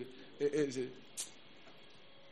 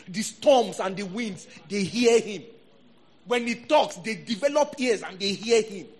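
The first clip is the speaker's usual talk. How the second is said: loud, high, urgent pulpit delivery; rousing Bible exposition; impassioned preaching; 6 semitones higher, 6 dB louder than usual. Real, not acted. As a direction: preach it hard, punching the questions and answers. Preaches with raised voice.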